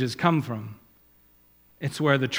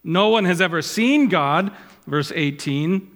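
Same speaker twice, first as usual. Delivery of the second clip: raised, measured reading of scripture; 3 semitones higher, 4 dB louder than usual. Acted. Preacher quoting Jesus to Martha, solemn reading. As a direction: declaim the words of Jesus. Quotes verse eighteen.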